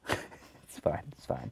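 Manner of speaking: soft whispered aside; whispering. Real, not acted. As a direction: whisper it to yourself. Self-mocking aside.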